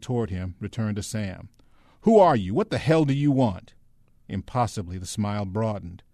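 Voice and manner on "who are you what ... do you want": high, loud, suspicious demand; agitated soldier's raised voice; hostile challenge. A narrator's reading. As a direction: fire the questions at a stranger. Armed soldier challenges the newcomer.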